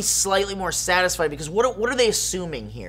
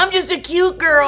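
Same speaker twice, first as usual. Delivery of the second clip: put-on high, loud girlish parody; mock cute girl; 11 semitones higher, 7 dB louder than usual. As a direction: ham up a crushy cutesy voice. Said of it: "like, crushy stuff".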